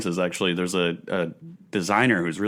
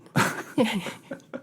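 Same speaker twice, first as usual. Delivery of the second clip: laugh; 14 semitones higher than usual. Real, not acted. Laughing at own impression.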